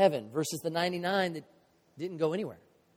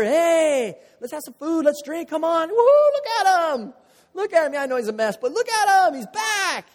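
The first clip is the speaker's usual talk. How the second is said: much higher and louder, whooping with joy; elated celebration; 11 semitones higher, 9 dB louder than usual. Pretend. Shepherd parades the rescued sheep to his friends, ecstatic and loud. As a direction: cheer like the party of your life.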